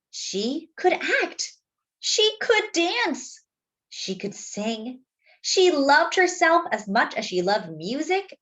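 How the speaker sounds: put-on gentle read-aloud for children; story narration. A narrator's reading. Narrator reading a story to young listeners, gently. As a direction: tell it like a bedtime picture book. Reads the picture book aloud.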